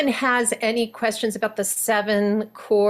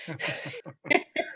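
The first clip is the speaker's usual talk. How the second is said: high laugh; 7 semitones higher, 7 dB quieter than usual. Real, not acted. Laugh after accent demo.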